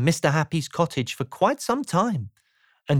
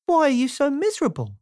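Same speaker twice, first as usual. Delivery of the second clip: high, quick character question; light, chirpy curiosity; Mister Happy; 10 semitones higher, faster than usual. A narrator's reading. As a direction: ask briskly in a high, cheery voice.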